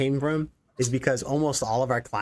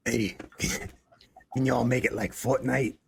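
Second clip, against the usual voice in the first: light, hammed-up Jersey client character; Jersey accent. Developer mimicking clients asking for Fortnite, mock Jersey accent. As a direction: put on a bit of a Jersey accent. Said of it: "this character impression that I would do".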